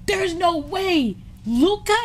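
high, loud, drawn-out disbelief; imitated screaming disbelief. Quoting friend's voice message about Luka, screamed disbelief. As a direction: mimic a stunned friend yelling, slowly. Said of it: "he's screaming".

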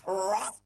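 a sudden loud mock roar of a beast arriving; imitated roar of the Bullenberg. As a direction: burst out with one loud roaring bark. The Bullenberg flies in roaring.

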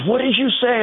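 very high, affronted imitation of her; indignant mock challenge. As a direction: throw it back high and offended. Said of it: "She goes".

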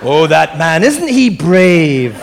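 very slow, loud swoon; swooning mock voice. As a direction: drag it out like a smitten fan. Mocking Star Trek line.

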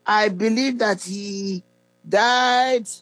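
slow, measured prayer recital with long pauses. Real, not acted. Preacher leading listeners to repeat a salvation prayer after him, slowly. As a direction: lead the prayer phrase by phrase, drawn out. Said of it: "I'm going to say it slowly".

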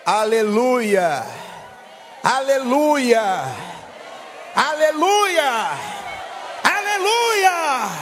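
slow, rising-pitch rallying calls to a congregation; building praise cry. Real, not acted.

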